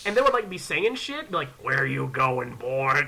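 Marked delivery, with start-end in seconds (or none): 1.6-3.1 low Southern haunted-house menace; Southern accent. Retold or imitated. Cornfield actor stopping a visitor, Southern accent. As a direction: drop low into a Southern threat. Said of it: "we're in the South".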